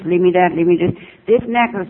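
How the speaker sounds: ordinary talk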